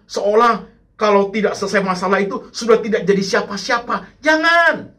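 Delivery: high, urgent sermon exhortation; forceful preaching. Real, not acted. Preacher exhorts congregation at full force.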